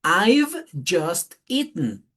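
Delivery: "I've just eaten" very slow, rising on "I've just", falling on "eaten"; example sentence. Shown as a demonstration. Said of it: "Y dice".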